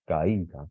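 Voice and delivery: "Caída" with weight on a separate í; stress on the I. Shown as a demonstration. Teacher showing intensity falls on I, stressed I. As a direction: let the stress land on í in "Caída". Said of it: "la intensidad recae sobre la I".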